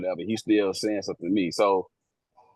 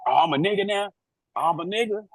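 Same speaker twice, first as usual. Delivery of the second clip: much higher, mock-offended questions; half-joking outraged retort; indignant disbelief; 9 semitones higher, faster than usual. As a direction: throw it back, pitched way up in disbelief. Officer reacting to slur.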